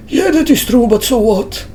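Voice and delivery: high, dismissive witness voice; squeaky, shrugging retort; high character voice. Acted.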